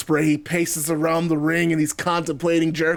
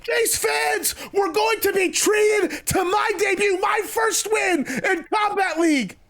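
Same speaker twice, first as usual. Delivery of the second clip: Jericho, outraged; 12 semitones higher than usual. Acted. Wrestler berates rival before fans, outraged high-pitched tirade. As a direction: accuse him at the top of your pitch.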